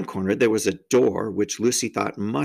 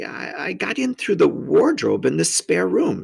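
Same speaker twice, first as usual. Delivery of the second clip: put-on hesitant girl; 7 semitones higher than usual. A narrator's reading. Girl answers the faun hesitantly.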